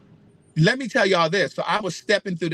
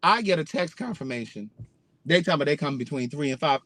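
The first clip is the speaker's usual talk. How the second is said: ordinary delivery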